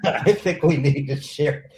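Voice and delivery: repeated through a laugh. Real, not acted. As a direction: say it again with a chuckle.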